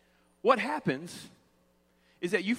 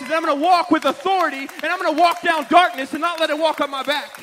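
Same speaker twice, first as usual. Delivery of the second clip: high, loud, driving preacher's delivery; full-force preaching; 6 semitones higher, 7 dB louder than usual. Acted. Preacher defying voices that say give up, forceful preaching. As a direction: pile it up like a fired-up preacher.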